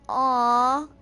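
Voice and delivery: long, loud, drawn-out aww; rising, stretched-out "aww"; sympathetic aww. Real, not acted. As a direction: coo a big aww in sympathy. Sympathetic reaction to the story.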